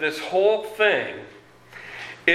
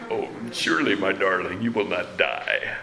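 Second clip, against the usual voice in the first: low, smooth Scottish charmer; Sean Connery; 8 semitones lower than usual. Acted. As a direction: woo her in with a deep, silky Scottish voice. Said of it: "I thought of Sean Connery".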